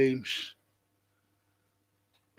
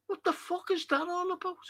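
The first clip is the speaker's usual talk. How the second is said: much higher, strained exasperated question; exasperated outburst; 11 semitones higher, 7 dB quieter than usual. Real, not acted. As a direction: throw up your hands in disbelief. Rant about club staff living elsewhere.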